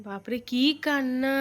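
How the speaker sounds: normal talking voice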